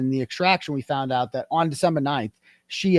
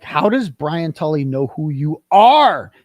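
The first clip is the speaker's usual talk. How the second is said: higher, indignant disbelief; retold incredulous challenge; 5 semitones higher, 5 dB louder than usual. Man confronting her about who knows her, retold indignation. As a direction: throw the question at her like an accusation.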